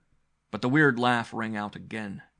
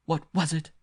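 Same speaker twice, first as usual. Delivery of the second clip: panting; 8 semitones higher, 5 dB louder than usual. A narrator's reading. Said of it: "the girl was panting".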